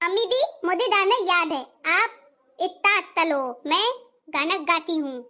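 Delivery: put-on "तलो" said with t in place of ch, a childlike lisp; lisping bride. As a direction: lisp every s and ch sound like a small child. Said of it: "तोतला के गाऊंगी और तोतला के बोलूंगी". (बच्चों जैसी तोतली बोली)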